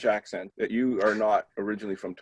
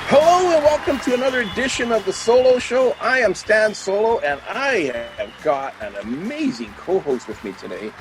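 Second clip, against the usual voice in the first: show host greeting; 9 semitones higher, 4 dB louder than usual. Acted. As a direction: belt out the show opening, stretching key words. Opens the show.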